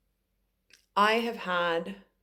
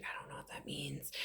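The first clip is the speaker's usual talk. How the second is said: whisper; 2 semitones lower, 14 dB quieter than usual. Retold or imitated. Medium whispering confusion to spirit.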